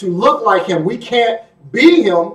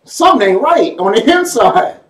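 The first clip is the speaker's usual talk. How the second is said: disgusted reaction; 5 semitones higher, slower than usual. Retold or imitated. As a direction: jolt back from a foul smell, laughing. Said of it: "you're like".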